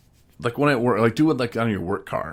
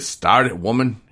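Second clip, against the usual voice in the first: loud, raised hillbilly order; mock gruff command; 4 semitones higher, 7 dB louder, slower than usual. Telling the lady to start her car, mock bossy shout. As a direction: order her about with hammed-up force.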